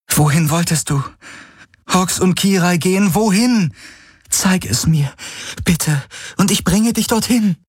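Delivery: put-on high, urgent girl's voice; Kirai urging. A narrator's reading. Kirai asks the dying Hawks where to go, high and urgent. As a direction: press him gently for an answer.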